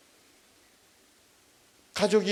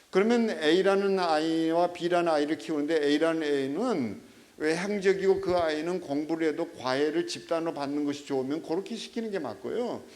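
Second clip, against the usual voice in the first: usual speaking voice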